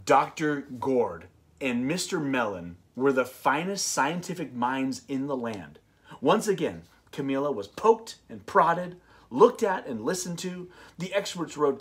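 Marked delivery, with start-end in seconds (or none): none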